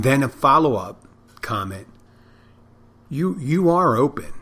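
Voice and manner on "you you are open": high-pitched mock amazement, stumbling on the first word; surprised caller. Woman caller's follow-up to the restaurant, surprised. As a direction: repeat it in raised-voice disbelief.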